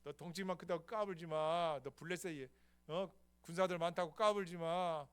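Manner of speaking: put-on brash, defiant young fighter; David taunting Goliath. David taunting Goliath before battle, brash. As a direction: taunt the giant, fearless. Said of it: "내가".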